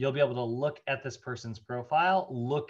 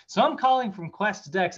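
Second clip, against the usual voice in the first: higher, louder chirpy caller opening; eager telemarketer; 7 semitones higher, 6 dB louder than usual. Retold or imitated. Caller launches into her pitch at dinner, in a bright higher voice. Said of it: "She's like".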